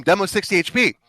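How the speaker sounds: loud, high urgent callout; retold scream to team. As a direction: scream the callout as you retell it. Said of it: "I'm screaming at my team".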